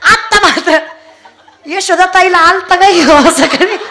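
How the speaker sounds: high, laughing, teasing village women. Acted. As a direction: tease her through laughter. (हसत चिडवणाऱ्या बायका)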